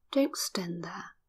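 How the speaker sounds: ordinary delivery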